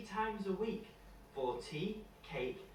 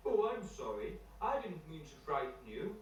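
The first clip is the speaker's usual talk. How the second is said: apologetic bear. Acted. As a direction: soften to say sorry as the bear.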